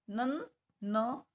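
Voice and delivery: नन and न stretched very slowly; slow sounding out. Shown as a demonstration. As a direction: break नन, न apart slowly. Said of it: "न पे एक और अंक की साउंड".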